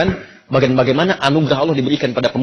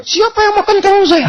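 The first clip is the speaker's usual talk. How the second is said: teriakan tinggi istri yang marah. Acted. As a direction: jerit soal terong yang hilang. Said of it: "dia teriak". (istri berteriak tinggi menanyakan terongnya)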